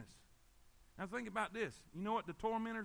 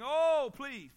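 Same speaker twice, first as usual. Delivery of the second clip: loud, higher mock plea; acted begging; 4 semitones higher, 9 dB louder than usual. Debtor begs the king over an impossible debt, loudly pleading. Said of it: "he begged the king".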